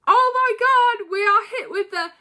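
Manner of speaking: high, loud fan outburst; squealing as a surprise return hits; excited squeal. Real, not acted. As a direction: shriek with pure excitement. Rock returns, she screams excitedly.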